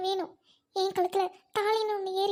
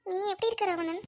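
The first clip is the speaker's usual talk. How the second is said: ordinary talk, nothing marked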